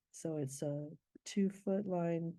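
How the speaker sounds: ordinary talk, nothing marked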